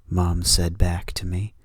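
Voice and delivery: usual speaking voice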